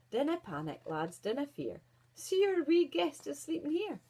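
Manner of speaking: steady elder, rising at the end; calm wise dwarf. Acted. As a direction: soothe the lads like the oldest dwarf. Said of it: "said Big Head".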